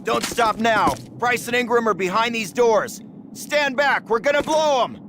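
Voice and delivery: high, urgent encouragement; keyed-up officer calling out orders; rallying the men. Acted. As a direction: push the squad on mid-fight. Captain urging men through assault.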